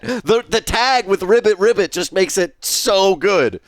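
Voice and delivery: ordinary delivery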